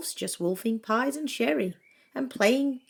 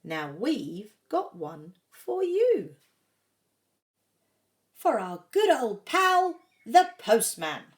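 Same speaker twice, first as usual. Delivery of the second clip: Santa voice; 5 dB louder than usual. A narrator's reading. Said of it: "says Santa".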